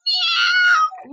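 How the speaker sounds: high mewing meow; cat meow. Acted. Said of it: "said the cat".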